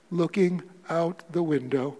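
ordinary talk

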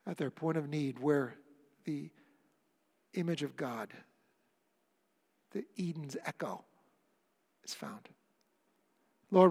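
measured prayer with long pauses; unhurried closing prayer, softening at phrase ends; pastoral prayer. Real, not acted. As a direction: speak earnestly to God on the congregation's behalf. Leads the congregation in closing prayer.

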